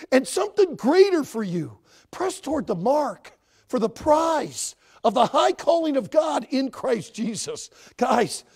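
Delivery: loud, driving sermon delivery; full-force preaching. Acted. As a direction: build to a fiery exhortation.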